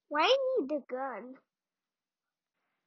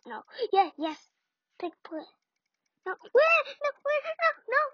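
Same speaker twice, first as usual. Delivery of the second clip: ordinary talk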